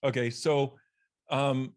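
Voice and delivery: ordinary talk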